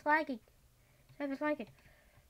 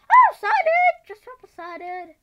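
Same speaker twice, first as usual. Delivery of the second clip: very high, loud excited yell; sudden thrilled squeal; excited shriek; 12 semitones higher, 22 dB louder, slower than usual. Real, not acted. Spots Enderman with cider.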